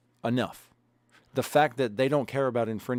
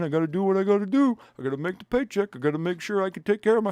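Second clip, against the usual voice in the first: higher, faster whiny mock cop voice; mocking cop following orders; 6 semitones higher, faster than usual. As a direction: parody a cop hiding behind orders. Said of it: "they're just fucking following orders".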